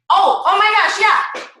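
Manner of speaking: high, loud burst of recognition; excited exclamation. Acted. Coach acting out a chat about her shake, excited exclamation. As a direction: exclaim as if just remembering.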